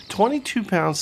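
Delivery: normal talking voice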